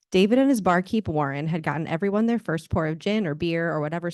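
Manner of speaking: steady reading of a novel aloud; story narration. Acted. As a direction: author reading her romance to an audience. Author reads her novel aloud.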